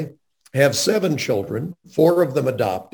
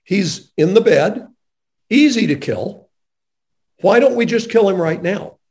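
higher, pointed challenge; indignant retort; 7 semitones higher, 3 dB louder than usual. Retold or imitated. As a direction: push back hard, pitched up. Father confronting the doctor, retold.

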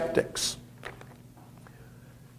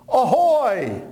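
mock sailor hail; 8 semitones higher than usual. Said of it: "his disciples didn't go".

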